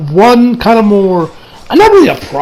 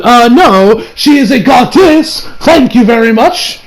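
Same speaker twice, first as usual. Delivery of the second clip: loud, higher, drawn-out insistence; indignant correction; 4 semitones higher, 3 dB louder, slower than usual. Retold or imitated. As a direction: correct them with emphatic, mock-offended force. Indignant correction of others in retold reaction.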